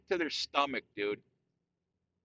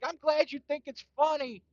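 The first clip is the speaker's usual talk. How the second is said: higher mock voice of the annoyed wife; sarcastic wife imitation; 10 semitones higher than usual. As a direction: sneer it back like the fed-up wife. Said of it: "And she's like".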